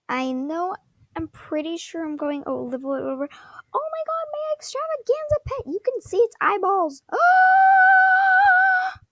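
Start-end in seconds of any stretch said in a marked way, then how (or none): 3.7-7.0 high-pitched excited squeal; excited freak-out. Real, not acted. Streamer spotting the pet's eyeballs, excited freak-out. 7.1-9.0 very high, loud excited scream; gasp, then let out a piercing shriek; really loud scream. Real, not acted. Streamer shrieks at the pet, really loud scream. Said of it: "Okay, that was really loud".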